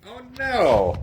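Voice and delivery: high, drawn-out dismayed yelp; loud sudden "oh no"; startled cry. Real, not acted. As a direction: cry out as something topples. Phone falls mid-joke.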